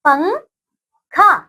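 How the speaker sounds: पंखा stretched very slowly, syllable by syllable; drawn-out spelling. Shown as a demonstration. Teacher to young pupils while writing पंखा, slow drawn-out word. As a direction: draw out पंखा slowly so children can write it. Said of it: "आइए यहाँ पे लिख देते हैं हम".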